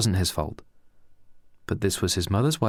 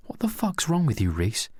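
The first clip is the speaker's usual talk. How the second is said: much higher, exasperated outburst; frustrated; 6 semitones higher than usual. Acted. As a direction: snap at him in frustration. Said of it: "said Pedro with frustration".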